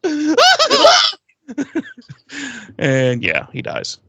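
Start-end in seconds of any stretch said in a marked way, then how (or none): 0.1-1.1 very high, loud comic shriek; hammed-up scream of fright; mock startled scream. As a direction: scream in pretend shock. Acts out his startled scream.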